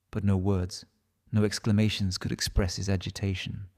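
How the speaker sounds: ordinary delivery, nothing marked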